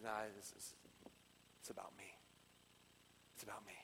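very low, barely audible voice; soft, low and final; silencing hush. Acted. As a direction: quietly settle it as Jesus. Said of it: "he silences all the other opinions".

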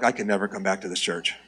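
low, hushed dude voice; deep, quiet mock grumbling; disgruntled dude. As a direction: mutter it low like a put-off guy in the pews. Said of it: "some dudes are out there like".

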